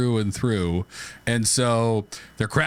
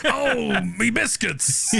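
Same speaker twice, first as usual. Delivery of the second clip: much higher, slow hammed-up Aussie panic; mock Aussie crook; 10 semitones higher, much slower than usual. Crew member panics as the loot floats, high hammed-up Aussie. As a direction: wail like a cartoon Aussie thief.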